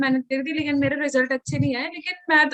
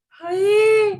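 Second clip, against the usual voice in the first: mock wail; 5 semitones higher, 6 dB louder, much slower than usual. Shocked by picnic fee.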